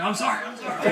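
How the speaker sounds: high, pleading pretend dog; apologetic dog voice. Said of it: "gets his look on his face like".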